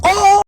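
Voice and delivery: sudden high-pitched yell; startling yell. Real, not acted. Vlogger yelling with a person walking nearby, high yell. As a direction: let out one high "Oh!". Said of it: "and I just yelled".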